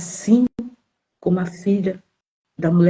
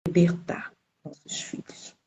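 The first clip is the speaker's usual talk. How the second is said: hushed emphasis; 8 dB quieter, slower than usual. Acted. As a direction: drop to a whisper on the promise. Pregação, ênfase sussurrada. (sussurro enfático)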